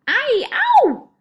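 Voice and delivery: very high, loud "ow" yelp; giant's pained howl. A narrator's reading. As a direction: howl out in your nightmare. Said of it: "growled the flesh lump eater".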